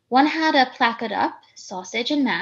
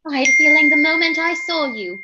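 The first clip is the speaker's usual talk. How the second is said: loud, theatrical claim of recognition; melodramatic declaration; 3 semitones higher, 8 dB louder than usual. Acted. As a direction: proclaim it like a long-lost child. Girl claims clerk as father.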